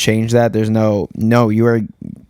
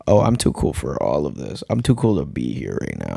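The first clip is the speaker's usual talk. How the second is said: mock too-cool attitude; 8 semitones lower, 5 dB quieter than usual. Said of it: "people that are like".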